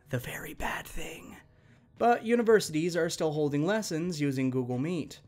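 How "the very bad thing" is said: hushed unvoiced whisper. Narrator whispering about the very bad thing. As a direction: whisper the taboo words like a secret.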